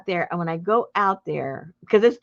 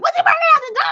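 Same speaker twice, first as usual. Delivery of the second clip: much higher, louder exasperated cry; exasperated exclaim; 7 dB louder, much faster than usual. Retold or imitated. Answering husband Richard's question in panic, high loud exclaim. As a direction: snap back the obvious answer, high and loud. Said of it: "And I went".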